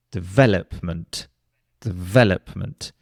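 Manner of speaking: development with the second syllable stressed; stress on VEL. Shown as a demonstration. Teacher showing the correct stress: development stressed on VEL. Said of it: "it has the stress on the second syllable".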